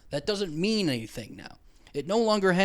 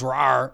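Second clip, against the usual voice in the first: lightly voiced mock lion roar; a casual, understated rawr like a lion; lion roar imitation; 3 semitones lower, 5 dB louder than usual. Shown as a demonstration. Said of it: "when it goes".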